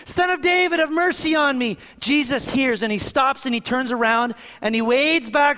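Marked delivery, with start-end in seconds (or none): 0.1-1.8 crying out. Retold or imitated. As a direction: shout to be heard over the crowd. Blind man calling out again.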